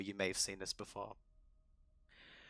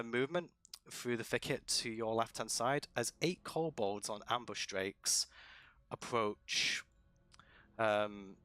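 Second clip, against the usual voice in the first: normal talking voice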